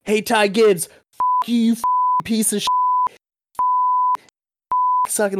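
high-pitched heckler going off; imitated angry tirade. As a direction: act out a dad cussing a driver out. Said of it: "This guy goes off".

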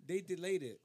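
usual speaking voice

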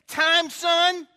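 loud, high, emphatic imitation of a father; booming fatherly command; stern dad. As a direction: lay down the law as a dad. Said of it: "my dad would say".

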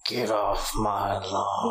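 put-on low, slow tough old man; Clint Eastwood. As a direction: growl it slowly as a mock warning. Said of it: "Clint Eastwood just does a bunch of these".

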